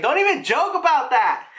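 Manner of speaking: high, agitated mock scolding; dad's scolding. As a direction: scold like a rattled dad. Retold dad scolds daughter.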